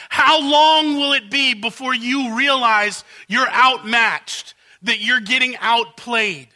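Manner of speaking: loud, slow, pleading cry of the royal court; pleading outcry. Acted. Servants crying out to Pharaoh, loud and slow. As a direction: drag out each word as if begging a king. Preacher voicing the servants' plea.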